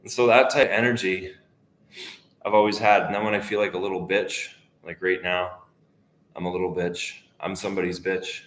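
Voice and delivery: usual speaking voice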